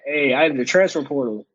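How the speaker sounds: usual speaking voice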